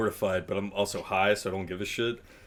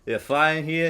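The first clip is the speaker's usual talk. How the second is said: lightly imitated Boston accent; a hint of Boston in the vowels; 4 semitones higher than usual. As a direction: retell it as a Boston firefighter, higher at the end. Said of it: "in a Boston accent".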